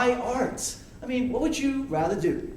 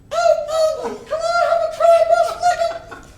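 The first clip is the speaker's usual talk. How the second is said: squeaky cartoon chipmunk, much higher and louder; 5 dB louder than usual. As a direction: pitch way up like a chipmunk puppet begging. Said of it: "doing this, uh, chipmunk voice into a microphone".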